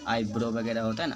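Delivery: ordinary talk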